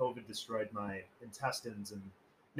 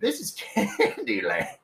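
high, loud, drawn-out Southern dandy; DiCaprio in Django; 5 semitones higher, 8 dB louder, slower than usual. Acted. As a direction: relish it slowly with a laugh breaking in. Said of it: "DiCaprio and Django".

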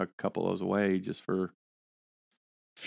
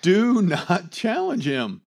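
laughing; 10 semitones higher, 5 dB louder, much slower than usual. Real, not acted. Laughing plea after repeating it.

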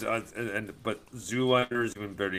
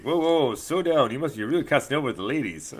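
comic book guy; 5 semitones higher, 4 dB louder than usual. Acted. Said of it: "or he's like".

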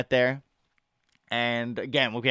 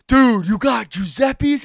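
high, loud, slow thrilled cry with a gasp; excited gasp; 7 semitones higher, 4 dB louder, much slower than usual. Retold or imitated. Friend discovers his favourite pizza, excited high shout. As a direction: gasp then shout it in delight. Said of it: "He's like".

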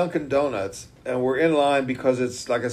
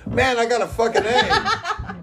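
put-on kid's excited boast; 11 semitones higher than usual. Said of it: "on his test and said".

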